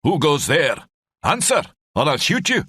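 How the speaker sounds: a Scottish sentry yelling halt at unseen men; Scottish sentry shout. A narrator's reading. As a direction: bark the challenge in a heavy Scottish accent. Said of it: "The voice came again".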